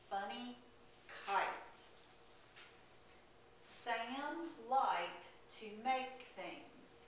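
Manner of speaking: slow, word-by-word read-along; reading a children's story aloud word by word; choral reading aloud. Acted. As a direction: read it together slowly so the kids can follow. Teacher reads story with class.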